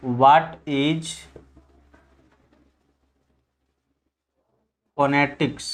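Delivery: low, word by word with long pauses; writing-speed delivery, big gaps between words; slow dictation. Real, not acted. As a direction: say it slowly as you write it out. Writing the question out for students.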